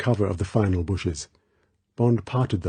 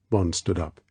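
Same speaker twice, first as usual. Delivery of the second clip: usual speaking voice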